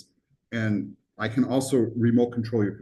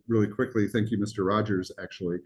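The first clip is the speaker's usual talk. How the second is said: ordinary talk, nothing marked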